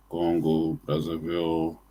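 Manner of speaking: normal talking voice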